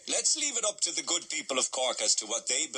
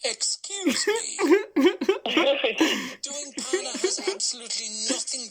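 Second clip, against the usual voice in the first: mock indignant; 11 semitones higher, 5 dB louder, slower than usual. Mock outrage at wrong answer.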